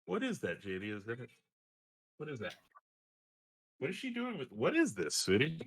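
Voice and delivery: soft, curious asking. Real, not acted. Father softly asking daughter what she holds. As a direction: coax her to show what she has.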